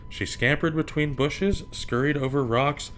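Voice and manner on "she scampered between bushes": usual speaking voice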